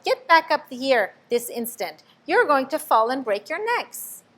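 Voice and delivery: put-on a mother ordering her children back up; scolding. A narrator's reading. Mother bird scolding chicks.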